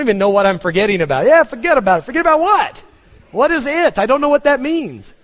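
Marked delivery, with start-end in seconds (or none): 1.3-2.0 louder, higher mock New Yorker catchphrase; New York accent. Pastor mimicking the New York catchphrase in a New York accent. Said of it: "Every sentence has "forget about it" in it". 2.0-5.1 baffled. Real, not acted. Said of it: "puzzled at the phrase".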